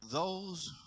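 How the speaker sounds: very quiet, low drowsy voice; sleepy Elijah. Acted. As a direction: say it softly, still waking up. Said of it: "wiping sleep from his eye".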